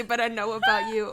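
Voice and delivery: teasing laugh; laughing shout. Real, not acted. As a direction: call it out while cracking up. Playful teasing line.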